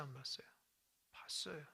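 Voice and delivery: whispered aside to the congregation; a hushed teasing question. Real, not acted.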